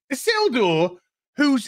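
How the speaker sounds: ordinary talk, nothing marked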